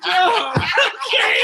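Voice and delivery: high, slow words through loud laughter; laughing helplessly. Real, not acted. Breaking into laughter remembering the night. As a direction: give in to the laugh.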